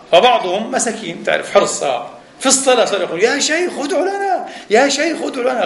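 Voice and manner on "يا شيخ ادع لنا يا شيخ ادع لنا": pleading worshippers. Retold or imitated. Worshippers begging the sheikh mid-prayer.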